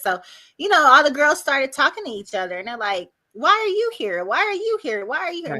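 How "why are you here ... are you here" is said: high, sing-song retelling of questions; mock round of high-voiced questions; acted questioning. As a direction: imitate inmates quizzing each other, pitched high. Girls questioning each other, acted.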